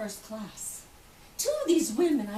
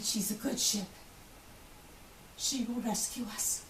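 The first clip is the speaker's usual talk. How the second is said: softer, hushed and steady; quiet reassurance; 8 dB quieter than usual. Retold or imitated. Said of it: "He said".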